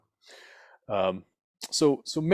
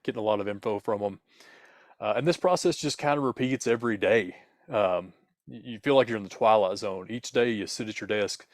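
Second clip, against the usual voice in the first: ordinary talk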